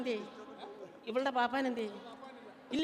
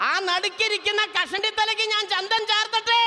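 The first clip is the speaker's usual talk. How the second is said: loud calling out; 10 semitones higher, 7 dB louder than usual. Real, not acted. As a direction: call the question out across the hall. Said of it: "repeats louder to the crowd". (ഉച്ചത്തിൽ വിളിച്ചു ചോദിക്കൽ)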